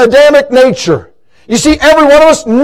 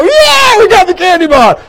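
triumphant cheer; 8 semitones higher than usual. Retold or imitated. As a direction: cheer like you got away with it. Boys celebrating.

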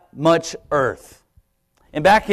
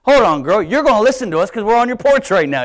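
higher and louder, indignant; prideful indignation; 5 semitones higher, 5 dB louder than usual. Retold or imitated. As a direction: hold on, girl, mock offended pride. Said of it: "my pride comes in".

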